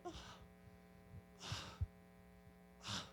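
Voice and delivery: soft, acted pained groans; short quiet gasps of mock pain. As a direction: imitate someone doubled over, groaning. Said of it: "watching her come in in pain".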